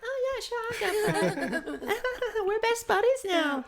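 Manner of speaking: very high, giggly mock-eager voice; Xandra. Friend gladly taking the stranger's fries, mock giggly squeal. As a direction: squeal it slowly through laughter, mocking her. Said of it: "and Xandra's like".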